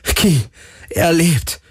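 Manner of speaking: very high, elated cries; jubilant. A narrator's reading. Galen rejoicing to Ki that he lives, jubilant. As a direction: cheer it out in amazement and joy. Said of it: "jubelte Galen".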